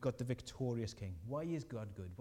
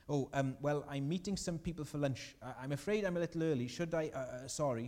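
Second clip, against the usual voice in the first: imitated flustered stammer, higher and hurried; mock-nervous, tripping into an apology; crumpling under pressure; 3 semitones higher, 3 dB louder than usual. Intimidated diner explaining himself to the waiter, crumpling under pressure. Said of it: "he just crumpled under the pressure".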